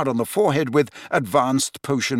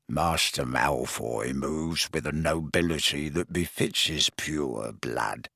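much lower, slow, quieter croak full of reverence; adoring croaking elf; 9 semitones lower, 7 dB quieter, slower than usual. Acted. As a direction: croak it in worshipful awe of his master. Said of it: "croaked Creature at once".

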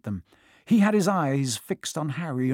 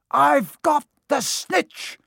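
high, slow, loud cry; triumphant shout; 11 semitones higher, 5 dB louder, much slower than usual. A narrator's reading. Harry announcing his catch to the crowd, shouting. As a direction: shout it out word by word. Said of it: "he shouted".

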